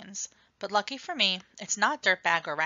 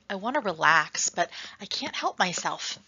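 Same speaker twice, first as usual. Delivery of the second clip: plain reading voice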